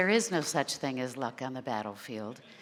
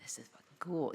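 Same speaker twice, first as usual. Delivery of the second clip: whispering; 4 semitones lower, 9 dB quieter than usual. Retold or imitated. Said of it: "I definitely was like".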